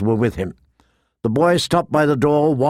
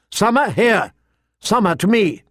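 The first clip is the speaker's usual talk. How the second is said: shouted command; 8 semitones higher than usual. A narrator's reading. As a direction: call the animal urgently to heel. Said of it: "Bran shouted from the high seat".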